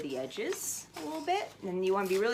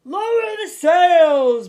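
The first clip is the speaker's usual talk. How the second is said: slow, much higher and louder bellowed order; pirate captain shouting; 10 semitones higher, 19 dB louder, much slower than usual. A narrator's reading. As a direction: bellow it over the storm. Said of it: "Braidbeard shouted".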